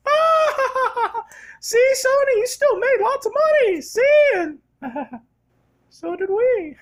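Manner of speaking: very high, giggling cartoon-mouse voice; gloating Disney mouse. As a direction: gloat at Sony in a squeaky falsetto. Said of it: "the mouse gets to go".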